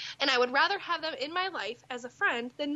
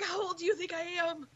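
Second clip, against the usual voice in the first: mock whimpering hurt; 5 semitones higher than usual. Mock hurt over cougar comment.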